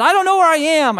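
high, loud mock-panicked wail; freaking out. Lost wife phones her husband, freaking out. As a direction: imitate a frantic caller, loud and high. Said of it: "She was freaking out".

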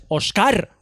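«Oscar» dicho osCAR, agudo; acento en la última. Shown as a demonstration. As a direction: carga la sílaba final de «Oscar». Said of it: "Con acento en la ar". («Oscar» con el golpe en «car»)

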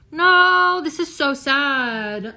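high, loud, drawn-out lament; dismayed wail. Real, not acted. Vlogger lamenting her computer to viewers, dismayed wail. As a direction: wail in dismay, stretching the words.

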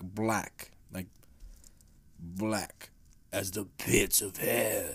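low, slow and drawn out; ominous low voice. Acted. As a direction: draw it out in a deep, dark storyteller's voice.

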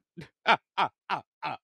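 loud staccato cackle; punchy "ah-ah-ah" laughing. Real, not acted. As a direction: laugh out in separate bursts. Laughs at own dead-alien remark.

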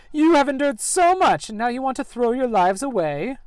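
hammed-up sneering villain; mocking villain. Villain scorning the heroes, high and loud. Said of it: "villain taunts the party".

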